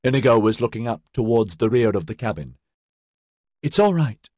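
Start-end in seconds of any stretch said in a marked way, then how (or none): 3.6-4.3 higher-pitched, bravely encouraging; valiant reassurance. A narrator's reading. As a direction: put on courage for a companion. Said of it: "she said valiantly".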